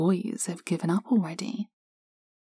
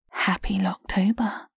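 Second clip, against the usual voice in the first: whispered in your ear. Acted. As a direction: breathe it right into the ear. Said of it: "I whisper in your ear".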